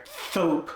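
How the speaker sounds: thope with tongue between teeth for soap; lisped s. Shown as a demonstration. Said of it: "thope for soap".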